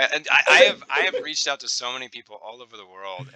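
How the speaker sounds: ordinary talk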